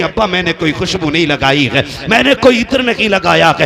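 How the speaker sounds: very loud, climbing plea; acted tearful protest. Amina denies perfume to her father-in-law, acted tearful protest. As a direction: protest loudly as the weeping widow. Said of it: "रो के कहती".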